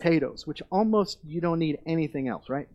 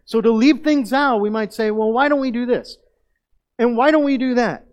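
raised, forceful preaching voice; loud sermon climax; 7 semitones higher, 10 dB louder than usual. Real, not acted. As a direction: drive the point home, loud and high.

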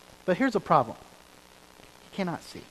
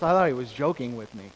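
ordinary delivery

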